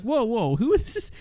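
acted double take in the voice; mock shock. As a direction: pitch leaps up on a baffled question. Acts out shock at nudity.

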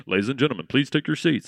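low, loud announcer boom. Retold or imitated. Theater announcer voice.

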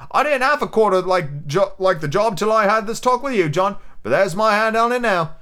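put-on young lad, won over. Lad pledges himself to Silver, eager. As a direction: play an eager young sailor.

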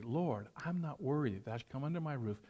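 soft, low murmured communion prayer; mumbled prayer. Shown as a demonstration. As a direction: murmur it under your breath like a congregation. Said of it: "Some of you hear us mumbling up there".

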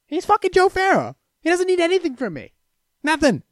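high, exclaiming emphasis; emphatic. Real, not acted. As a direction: exclaim it in disbelief. Repeats line, second time emphatic.

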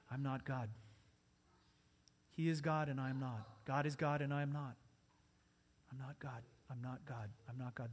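low, hushed repeated prayer; subdued, low-voiced confession to God; quiet prayer. Shown as a demonstration. Models a short prayer under stress.

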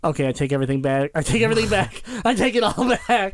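rising to high, loud excitement with a laugh; excited. Retold or imitated. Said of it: "was like".

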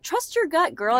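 put-on sassy girlfriend advice. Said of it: "women are always like".

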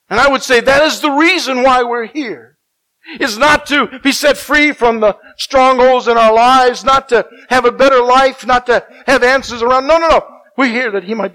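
loud, high, impassioned plea; full-force preaching. Real, not acted. Preacher pleading with God before the congregation, loud and high. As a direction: cry out for revival.

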